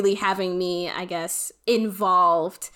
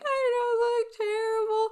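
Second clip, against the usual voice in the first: put-on teary kid; 14 semitones higher than usual. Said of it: "having, like, dried tears on my face".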